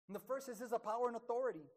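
ordinary talk, nothing marked

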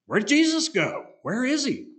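high-pitched puzzled questions; baffled searching. Retold or imitated. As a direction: act out bewildered followers looking for someone. Acting out disciples searching for Jesus.

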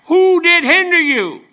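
very high, loud, slow held cry; climactic preacher's cry. Acted. Preacher rebuking quitters, slow, very high and loud. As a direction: stretch every word out like a pulpit climax.

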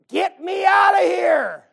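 high, slow strained cry to be let out; mock desperate shout. Preacher voicing the unborn child in labor, hammed-up shout. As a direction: yell it like a baby stuck in the womb. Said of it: "what the Lord's saying".

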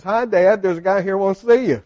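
put-on a kid bursting in on his dad; cheerful little boy. Boy enters coach's office.

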